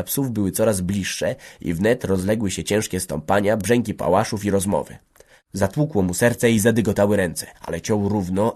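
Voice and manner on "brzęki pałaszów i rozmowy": ordinary delivery, nothing marked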